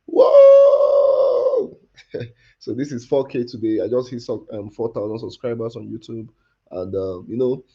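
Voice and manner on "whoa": high, loud hype man whoa; excited shout. Pretend. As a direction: shout it to pump up the crowd. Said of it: "let me form a hype man".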